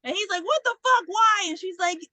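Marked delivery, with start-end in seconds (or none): 0.4-1.5 high, loud mock indignation; indignant outburst. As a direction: demand an answer, imitating his shock. Man reacting, retold quarrel.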